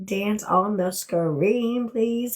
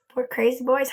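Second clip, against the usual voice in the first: usual speaking voice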